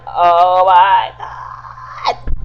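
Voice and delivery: very drawn-out, loud disbelief; retold shock. Viewer reacts to her fall, long loud retold shock. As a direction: stretch every word in dramatized horror.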